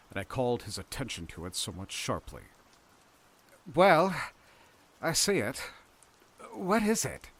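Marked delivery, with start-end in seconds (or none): 3.8-7.3 higher-pitched questioning character voice; voiced higher than the narrator; Lewis. A narrator's reading. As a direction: lift the voice sharply, quizzical. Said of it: "said Lewis".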